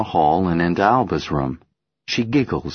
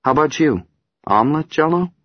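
teasing; 9 semitones higher, 3 dB louder, faster than usual. Acted. Mother teasing.

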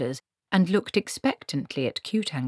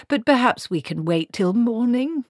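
higher, tentative plea; moaning plea; 7 semitones higher, 4 dB louder than usual. A narrator's reading. Wizard begging Granny to wait, mildly moaning. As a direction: plead mildly, lifting at the end. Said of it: "Q-Tangle moaned".

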